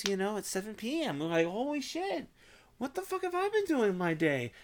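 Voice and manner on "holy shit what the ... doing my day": higher-pitched, mock-stunned outburst; mock dismay at lost time; shocked realization. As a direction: act out suddenly noticing the lost day. Acting out losing track of time.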